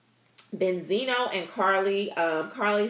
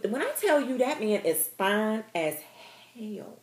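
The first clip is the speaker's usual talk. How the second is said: normal talking voice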